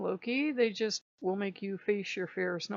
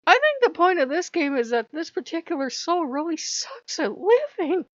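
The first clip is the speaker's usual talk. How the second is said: high, loud, worked-up verdict; hammed-up frustrated outburst; mock exasperation; 10 semitones higher, 8 dB louder than usual. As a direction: declare it in comic despair. Acted verdict on the game.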